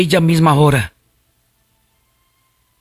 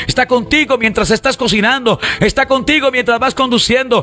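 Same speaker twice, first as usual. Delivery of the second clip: raised pitch, urgent and unbroken preaching; fervent preaching; 5 semitones higher than usual. Acted. Preacher assuring believers that God is with them, fervent. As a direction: build higher as the assurances pile up.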